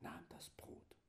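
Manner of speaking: toneless whisper. Shown as a demonstration. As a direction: whisper the gospel words with no voice. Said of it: "Stimmlos geflüstert". (stimmlos geflüstert)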